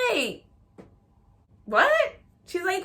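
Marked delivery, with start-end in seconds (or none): 1.7-2.2 very high, loud squeak of surprise; stunned disbelief. Retold or imitated. Daughter thrown by mom's gender reveal request, stunned squeak.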